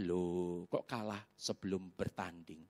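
low, slow, soft voice of God; unhurried, deep-pitched divine reproof; God's gentle challenge. Retold or imitated. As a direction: question him calmly and quietly, drawn out. God questioning him in his story.

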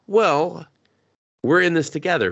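high, bright put-on girl voice; lightly voiced perky girl; cheerful heroine. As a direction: say it like a plucky teammate. Heroine joins him in fight.

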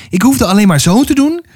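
high-pitched, mildly excited retelling; exclaiming. A narrator's reading. Girl shows her friend what she did in a dream, exclaiming. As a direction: keep the pitch up as the story spills out. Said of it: "riep ze uit".

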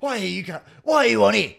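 high, loud indignant yell; mock screaming. Imitating passenger screaming on the tram, high yell. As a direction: screech it like an outraged passenger. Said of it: "screaming about it".

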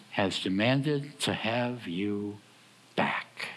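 demanded to have you said slowly, back almost whispered; slow ominous build to back; slow, hushed emphasis. Shown as a demonstration. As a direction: drag it out and breathe back. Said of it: "Put back on the end of that".

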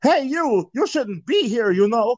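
high, hammed-up game villager; odd, singsong high voice; odd character voice. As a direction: put on a silly high character voice. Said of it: "I don't know why he sounds like that".

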